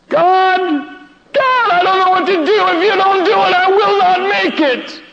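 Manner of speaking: high, strained cry to God; begging God for rescue; desperate prayer. Retold or imitated. Speaker pleading to God in despair, high strained cry.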